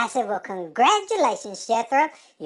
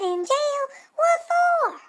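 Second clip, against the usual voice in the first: mock shock; 14 semitones higher, slower than usual. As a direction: squeal the question in comic alarm. Shocked at friend's arrest.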